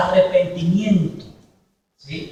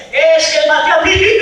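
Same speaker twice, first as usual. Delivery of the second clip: higher, louder confession of the penitent; confessing penitent; 9 semitones higher, 7 dB louder than usual. Retold or imitated. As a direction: blurt out the confession.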